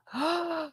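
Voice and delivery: a sudden, high, breathy intake of surprise; sharp gasp. Real, not acted. Streamer gasps at a viewer's political tip. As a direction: gasp like the news just landed. Gasping at chat confirmation.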